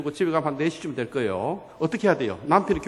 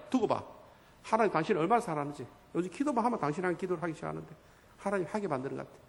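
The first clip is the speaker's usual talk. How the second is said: soft, quick confiding murmur; acted gentle reassurance; tender wife; 7 dB quieter, faster than usual. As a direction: confide lovingly in a softened voice. Acted loving wife reassures husband.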